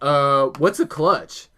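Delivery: slow, drawn-out puzzled question; imitated cluelessness. Friend asked to drive stick shift, slow mock-clueless reply. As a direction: stretch it out like someone with no idea.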